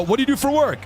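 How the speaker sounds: light mock-friendly question; mock stranger asking. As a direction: play a nosy stranger asking about the job. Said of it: "they go".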